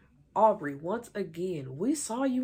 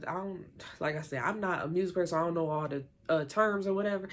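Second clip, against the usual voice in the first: ordinary talk, nothing marked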